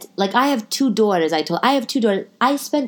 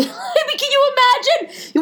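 much higher, breaking into laughter; laughing. Real, not acted. Picturing a friend's bleaching ordeal, laughing hard. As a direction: laugh through the question.

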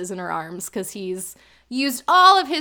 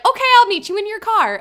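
very high, loud, chirpy acting; mock-oblivious high eagerness; undeterred eagerness; 10 semitones higher, 6 dB louder, faster than usual. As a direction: invite yourself along, imitating shameless cheer. Undeterred after being told not invited.

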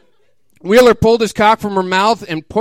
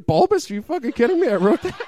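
incredulous disbelief; 5 semitones higher, 3 dB quieter, faster than usual. Real, not acted. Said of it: "author mocking own writing".

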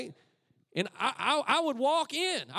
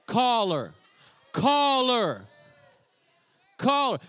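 loud urging; 9 dB louder, much slower than usual. Real, not acted. Urging crowd loudly.

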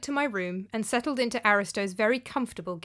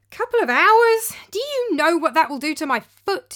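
whiny objection; 8 semitones higher, 8 dB louder than usual. Acted. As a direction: protest like a spoiled man. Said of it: "he objected".

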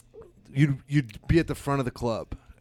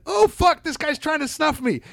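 high, loud mock panic; panicked high yell; 13 semitones higher, 5 dB louder than usual. Comic to buddy, high panicked yell. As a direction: squeal in fake terror. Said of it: "I went".

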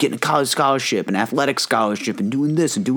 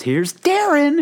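slow, high, showy host announcement; mock-announce the big reveal; much slower than usual. Host presenting Darren to his dad, slow showy announcement.

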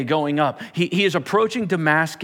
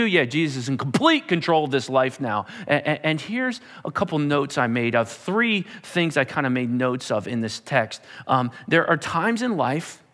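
ordinary talk, nothing marked

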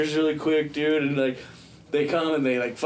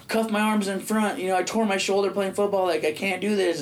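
higher-pitched retold pleading; pleading protest; 6 semitones higher than usual. Cuffed man bargaining with cops over his shoulder, pleading. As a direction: argue your case urgently, pitch raised.

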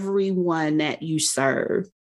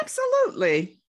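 excited; faster than usual. Real, not acted. Excited agreement.

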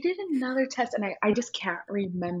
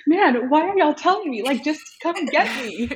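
mock exasperation; 7 semitones higher, 6 dB louder than usual. Retold exasperated reply to nurse.

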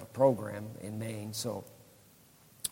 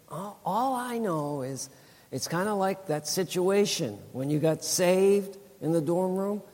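higher, marveling as she describes the vision; a touch of awed wife; 8 semitones higher, 4 dB louder than usual. Retold or imitated. Wife telling husband her vision, lightly voiced awed wonder.

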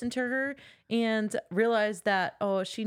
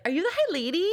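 high-pitched, starstruck monster voice; high, rising gushy question; excitable monster; 10 semitones higher than usual. Acted. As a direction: play the monster as a squealing fan. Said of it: "is that how you picture it".

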